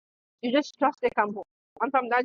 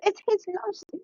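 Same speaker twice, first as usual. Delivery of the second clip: higher, fast gushing bestie voice; a friend hyping up her dumped bestie, higher and gushing; mock hype bestie; 6 semitones higher, faster than usual. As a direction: gush like a cheerleading bestie. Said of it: "young women hype for the young women".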